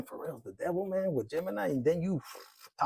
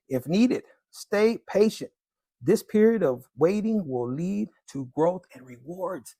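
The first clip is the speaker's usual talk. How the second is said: louder, slow and deliberate card read; 5 dB louder than usual. Acted. Tarot reader reading the card's message to viewers, slow and loud.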